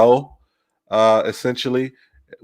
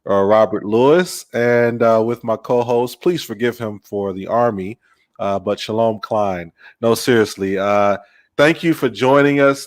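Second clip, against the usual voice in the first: ordinary delivery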